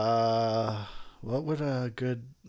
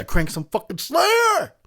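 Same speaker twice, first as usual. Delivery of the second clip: loud, high metalhead yell; hyped; 7 dB louder, slower than usual. Real, not acted. Fan calling for Slayer to be cranked, hyped. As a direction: draw out the band name in a hyped shout. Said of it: "I get hyped".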